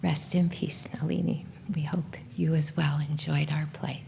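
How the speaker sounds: real whispered farewell; hushed farewell. Real, not acted. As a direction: speak gently for Nalini. Memorial farewell.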